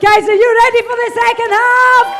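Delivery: loud, high announcer call to the room; host announcement. Real, not acted. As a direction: whip up the crowd for the next act. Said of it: "Please welcome back to stage".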